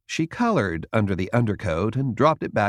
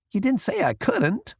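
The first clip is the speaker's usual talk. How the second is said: put-on a touch of hurt in a raised young voice. A narrator's reading. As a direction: sound wounded, lightly. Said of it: "The intern sounded hurt".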